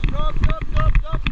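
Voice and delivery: high loud shouted stops; shouting. Real, not acted. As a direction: yell it over the rapids. Rafting guide calling over rapids.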